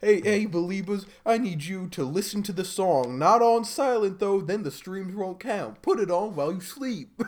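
high, put-on Georgia-accented pop star. Pop star telling fans to stream his song, high impression. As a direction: coax the fans in a high mock Southern voice. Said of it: "I don't know why he has a Georgian accent".

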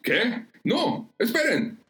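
high-pitched, panicky disbelief; confused alarm. A narrator's reading. Pierce realizing the crew may drop his rope, confused alarm. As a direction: plead in a high, bewildered voice. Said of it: "confundido también".

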